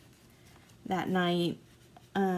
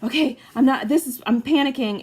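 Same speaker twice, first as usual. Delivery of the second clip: higher, louder panicky voice; panicking; 6 semitones higher, 9 dB louder than usual. Retold or imitated. Admitting to herself she panics, higher and louder. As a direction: let the panic rise in the voice. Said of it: "I was like".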